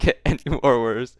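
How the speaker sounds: usual speaking voice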